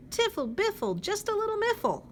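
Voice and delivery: high, loud singsong nonsense; bouncy high chirpy rhyme; silly bird song. Acted. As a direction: chirp it out gleefully.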